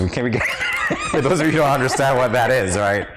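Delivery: ordinary delivery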